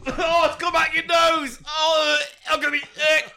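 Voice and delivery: high shouting in disgust. Real, not acted. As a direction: yell out grossed out. Snot comes out, shouting.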